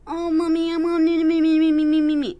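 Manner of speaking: put-on high, loud, whining little-kid plea; mock toddler whine on one high pitch; whiny child. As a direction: squeal it like a clingy kid begging mommy. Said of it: "what kind of fucking octave are they talking in".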